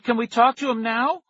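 Can we talk pitched high and pleading; pleading cry. A narrator's reading. Tara begs the scientist, high pleading cry. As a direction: push the whole line up in pleading. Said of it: "Tara cried".

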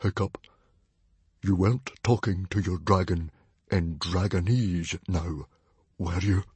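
careful, measured menace in separate phrases; dangerously calm. Acted. Father questioning his son, dangerously calm. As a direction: hold the anger under careful calm. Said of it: "dangerously, carefully calm".

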